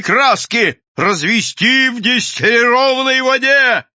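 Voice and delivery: high, loud recital building to a yell; shouting. A narrator's reading. Mad inventor announcing his formula from the cab, shouting. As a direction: proclaim a recipe with manic triumph. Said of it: "кричал Бабский".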